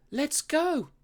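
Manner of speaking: eager high shout of a wolf pup. A narrator's reading. Little wolf shouting to the pack, high eager shout. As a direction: call it out bold and high. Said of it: "shouted Wilf".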